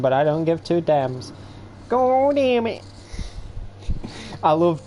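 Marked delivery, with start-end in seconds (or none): 1.9-2.8 high, hammed-up hillbilly cry; mock hillbilly accent pitched way up. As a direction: play a cartoonish trailer-park hillbilly cursing. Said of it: "hillbilly voice".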